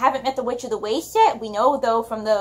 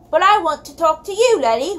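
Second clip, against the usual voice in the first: louder, higher lightly voiced farm lad calling out; yelling; 4 semitones higher, 4 dB louder than usual. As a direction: holler at the girl over the counter. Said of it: "one of the farmer's sons yelled".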